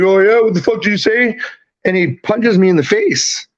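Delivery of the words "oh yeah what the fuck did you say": aggressive taunt. Retold or imitated. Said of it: "he's like".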